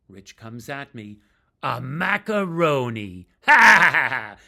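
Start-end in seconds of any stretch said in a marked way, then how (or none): 1.6-3.3 mock declaration. Bandmate proclaiming the fancy-dressed singer a macaroni, loud. Rich mocking narrator's finery. 3.4-4.4 loud, high mocking belly laugh. Bandmate laughing at the singer onstage, loud mocking laugh. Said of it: "And he's laughing".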